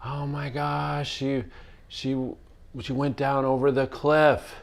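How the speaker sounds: imitating a horrified realisation; mock panicked cry. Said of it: "Allison's like".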